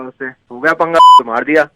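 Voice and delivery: ordinary delivery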